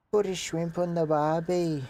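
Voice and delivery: high, hammed-up Australian bogan accent; mock Australian accent. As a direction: lay on a cartoon Australian twang. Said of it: "I'm a bogan".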